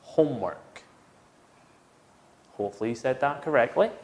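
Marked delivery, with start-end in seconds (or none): 0.0-0.8 homework with stress on home and a falling end; model pronunciation. Shown as a demonstration. Teacher modelling homework for students to repeat. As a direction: model homework clearly for repetition. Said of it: "So say it after me".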